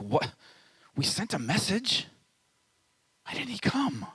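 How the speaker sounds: rising, high-pitched bewildered question; confused protest. Retold or imitated. Grieving sister asking why Jesus never came, in a high bewildered voice. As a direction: ask it like you can't believe he didn't come. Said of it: "the sisters are grieving and maybe angry, maybe confused".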